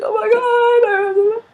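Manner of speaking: high, drawn-out helpless squeal; crying with laughter. Retold or imitated. Teller laughing till crying at the teasing, imitated high wail. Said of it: "by now I'm crying".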